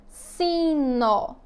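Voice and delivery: "sino" drawn out as si, nó; syllable by syllable. Shown as a demonstration. Teacher demonstrating syllable division, "sino" said syllable by syllable. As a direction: stretch "sino" into two clear beats with open final o. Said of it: "fazer a separação silábica da palavra".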